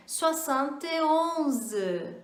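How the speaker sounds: "soixante et onze" spoken slowly; slow model. Shown as a demonstration. Teacher spells out seventy-one slowly for learners. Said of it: "vamos treinar mais uma vez".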